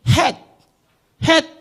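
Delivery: hat, hat with a short vowel and crisp final t; reading demonstration. Shown as a demonstration. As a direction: model hat, hat as two separate short words. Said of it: "이렇게 읽어야죠".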